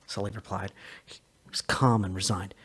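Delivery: ordinary delivery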